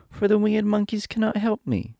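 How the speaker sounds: put-on high girlish lament; disappointed Dorothy. A narrator's reading. Dorothy finds the monkeys cannot help, high disappointed voice. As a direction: complain sadly in a high little-girl voice. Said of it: "almost ready to cry with disappointment".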